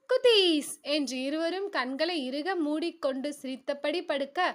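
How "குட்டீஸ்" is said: put-on high, loud kids' answer; two little kids proudly answering their father, high and loud; children's chorus. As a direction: chirp it out like happy little kids. Children answering bedtime question.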